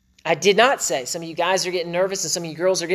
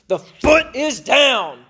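a hammed-up stern decree, higher, louder and very slow; mock growly husband; 8 semitones higher, 7 dB louder, much slower than usual. Husband mocking a heavy-handed decree to his wife, slow and loud. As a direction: lay down the law like a pompous husband, half joking. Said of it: "you don't have to say it with a growly voice".

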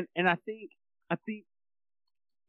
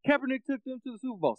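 mocking people's claim; 7 semitones higher, faster than usual. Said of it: "And people are like".

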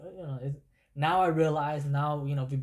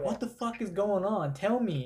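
higher-pitched, mock-exasperated demand; retelling his own alarmed questioning; retold urgent demand; 7 semitones higher than usual. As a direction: demand an answer, pitch jumping up. Demands answers from wife in story.

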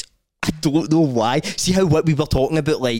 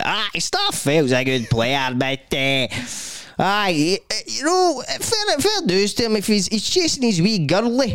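drawn-out caller voice climbing higher; radio phone-in caller; 6 semitones higher, slower than usual. Retold or imitated. Caller on Super Scoreboard about Starfelt, hammed-up impression. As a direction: ramble along in a high, hammed-up caller voice. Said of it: "What, an old guy?".

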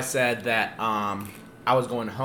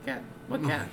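playing innocent; 10 semitones higher, 10 dB quieter, much faster than usual. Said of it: "And I'm like".

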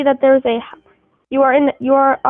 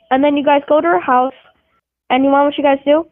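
ordinary delivery

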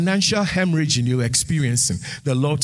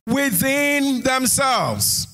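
full-force preaching; 8 semitones higher than usual. Acted. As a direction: preach it out, lifting the congregation. Sermon climax with music.

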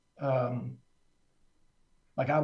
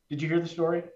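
ordinary talk, nothing marked

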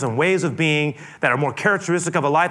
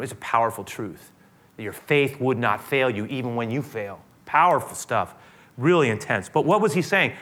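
usual speaking voice

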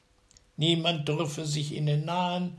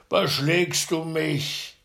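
slow, louder, drowsy complaint; grumpy waking giant; 4 semitones higher, 6 dB louder, slower than usual. A narrator's reading. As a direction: woken by a stone on the chest. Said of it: "stieß seinen Gesellen an und sprach".

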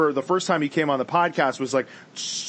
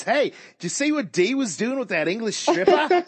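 put-on higher, lilting Aussie accent; Australian accent; 6 semitones higher than usual. Scene: Aussie friend gossiping to a guy, Australian accent. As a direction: play a gossiping Australian mate. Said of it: "I love that Aussie accent".

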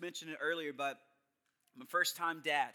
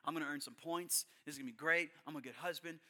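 quick quiet self-talk; 4 semitones lower, 7 dB quieter, faster than usual. Retold or imitated. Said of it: "I'm like".

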